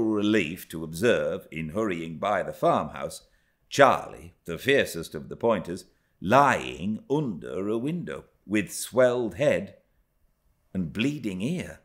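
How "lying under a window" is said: usual speaking voice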